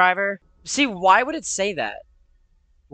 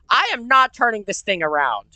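ordinary delivery

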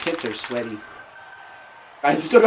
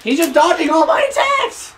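frustrated shout; 13 semitones higher than usual. Real, not acted. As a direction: yell it out in frustration. Frustrated gamer yelling.

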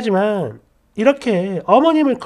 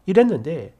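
ordinary delivery

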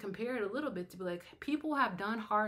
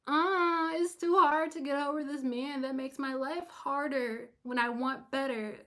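mock whine; 5 semitones higher, 6 dB louder than usual. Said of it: "what do I look like being like".

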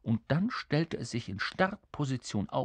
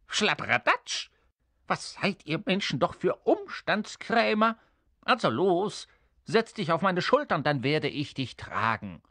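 high, scolding imp voice; exasperated little creature; 6 semitones higher than usual. Acted. Little creature scolding the fussy king, exasperated. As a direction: scold impatiently, then settle at the end.

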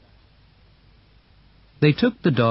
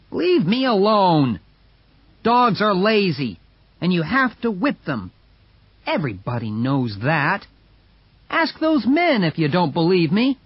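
dismissive brother; 7 semitones higher than usual. Acted. Hal arguing with sister.

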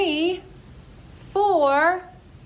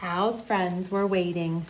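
ordinary delivery

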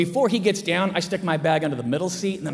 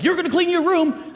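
mock scolding mom; 10 semitones higher, 4 dB louder than usual. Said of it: "I was in a big fight with my mom".

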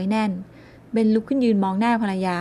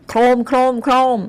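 door pounding; 4 semitones higher, 7 dB louder than usual. A narrator's reading.

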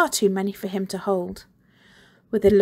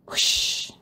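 whoosh sound. A narrator's reading. Rushing past sound.

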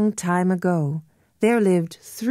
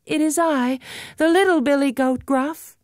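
little goat voice; 8 semitones higher, faster than usual. Acted.

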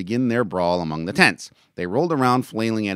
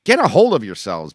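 loud, high exasperated plea; begging; 7 semitones higher, 11 dB louder than usual. A narrator's reading. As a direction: plead with them, loudly. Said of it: "Coates begged".

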